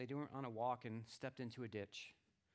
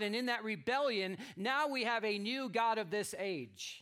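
raised, louder preaching voice; 8 semitones higher, 10 dB louder than usual. Acted. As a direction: proclaim the story to the congregation. Preaches on the usurped rule.

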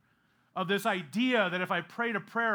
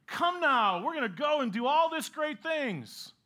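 sarcastic; 7 semitones higher than usual. Retold or imitated. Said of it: "Somewhat sarcastically".

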